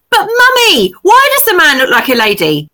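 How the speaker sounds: put-on high, loud child insisting on an answer; insistent child voice. Mother imitating her son pressing the embarrassing question. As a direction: whine "but Mummy" like a toddler. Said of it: "my eldest said in a very, very loud voice".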